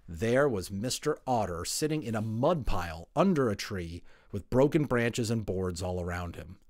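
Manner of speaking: ordinary delivery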